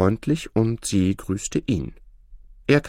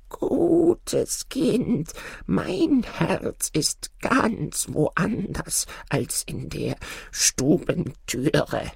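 put-on high, slow old man's voice; the old man answering gently, drawn out; 10 semitones higher, 3 dB quieter, much slower than usual. A narrator's reading.

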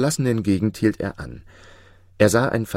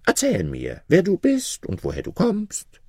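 inquiring jinni; 11 semitones higher than usual. A narrator's reading. Jinni asks Maruf who he is, inquiring kindly. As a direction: ask in a high creature voice.

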